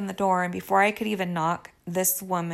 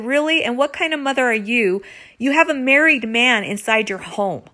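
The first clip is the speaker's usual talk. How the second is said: louder, higher retold comeback; indignant retort; 4 semitones higher, 5 dB louder than usual. Wife retorting to the other woman, indignant. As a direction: throw the accusation back at her.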